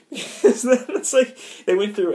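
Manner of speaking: usual speaking voice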